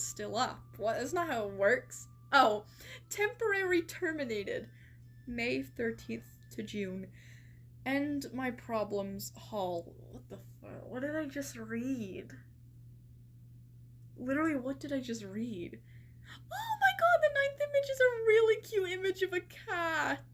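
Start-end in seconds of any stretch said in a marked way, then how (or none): 2.3-4.7 shocked. Real, not acted. Shocked by profile reading. 10.1-12.3 whispered disbelief; stunned whisper. Real, not acted. Stunned by the bizarre profile. 16.3-20.2 very high, loud squeal of delight; squealing over a cat. Real, not acted. Squealing over a cute cat picture.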